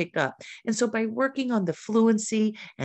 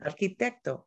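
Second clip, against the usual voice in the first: Spanish cognate, said as a model; teacher's Spanish word demo; Spanish pronunciation; 3 dB quieter than usual. Shown as a demonstration. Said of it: "similar across the languages".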